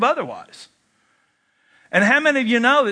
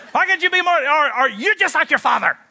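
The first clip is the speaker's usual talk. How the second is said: higher, mock scolding parent; nagging parent; 7 semitones higher than usual. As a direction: nag like a disappointed mother.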